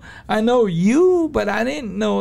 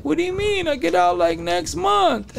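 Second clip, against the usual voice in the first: higher-pitched, baffled retort; retold puzzlement; 10 semitones higher than usual. Inmate answering a mistaken friend, retold puzzlement. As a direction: imitate a puzzled reply, pitched well up.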